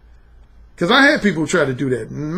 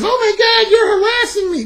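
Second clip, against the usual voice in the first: high, alarmed mock outcry; outraged woman; 4 dB louder than usual. Woman accusing the man who approached her, mock outrage. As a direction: mimic an offended woman crying out, pitched way up. Mimicking an offended woman rejecting him.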